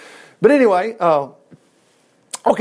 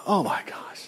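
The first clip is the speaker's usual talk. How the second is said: breathy whispered aside; whispered dismay; 10 dB quieter than usual. Real, not acted. Preacher whispering his dismay at corruption to the congregation. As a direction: whisper it in disbelief.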